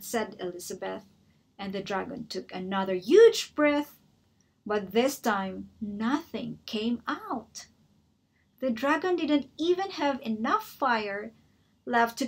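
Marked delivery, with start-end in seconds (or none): none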